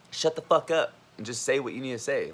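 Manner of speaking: usual speaking voice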